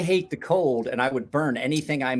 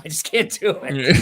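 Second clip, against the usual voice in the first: laughing; 4 semitones higher, 6 dB louder than usual. Real, not acted. Laughing at own clumsiness.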